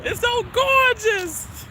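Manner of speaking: high, drawn-out gushing; gushing delight. Real, not acted. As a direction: stretch it out in a high swoon of delight. Gushing over the falling snow.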